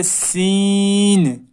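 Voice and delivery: سین drawn out, light and thin; thin letter. Shown as a demonstration. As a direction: stretch سین fine and thin. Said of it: "بالکل باریک ہوگا یہ سین".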